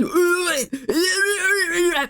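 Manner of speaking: high wailing mock walrus bellow; hammed-up imitation walrus wail; walrus noise. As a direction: wail like a man with no tongue playing walrus. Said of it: "makes walrus noises".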